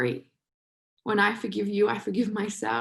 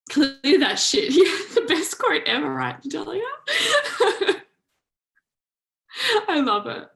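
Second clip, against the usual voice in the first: excited laughing; 7 semitones higher, 5 dB louder than usual. Real, not acted. Excited reaction to chat quote.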